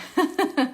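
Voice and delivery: high, loud burst of laughter. Real, not acted. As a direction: laugh at yourself. Laughs at herself.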